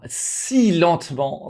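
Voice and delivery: slow, each syllable stretched; drawn out. Real, not acted. Telling viewers how slowly the surgery felt, stretched out. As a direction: stretch it out like time crawling. Time passing during surgery.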